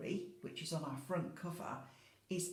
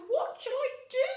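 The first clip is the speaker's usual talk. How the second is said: put-on distressed old lady; 12 dB louder than usual. Old lady crying out over her crowded house, high despairing voice. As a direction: cry out in cramped despair. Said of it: "she says".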